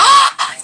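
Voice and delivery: sharp high gasp of delight; a quick thrilled intake of breath; excited gasp. Real, not acted. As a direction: gasp of glee.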